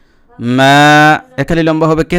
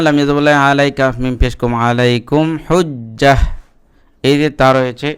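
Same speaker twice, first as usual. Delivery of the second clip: ordinary delivery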